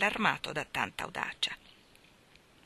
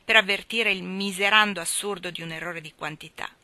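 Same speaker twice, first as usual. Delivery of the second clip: plain reading voice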